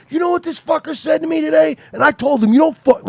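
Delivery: high, loud, worked-up retelling; indignant, punchy mock rant; fired up. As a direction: imitate someone still heated about a fight. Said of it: "you get fired up and then you're like".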